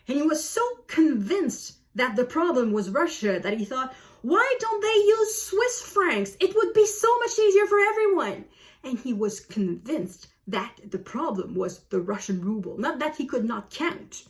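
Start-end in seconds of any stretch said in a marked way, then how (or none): 4.2-8.4 mimicking the whiny boy. Mimicking complaining Swiss boy.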